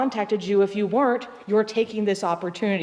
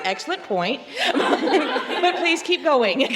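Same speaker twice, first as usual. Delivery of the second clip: laughing; 8 semitones higher, slower than usual. Retold or imitated. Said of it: "I'm like".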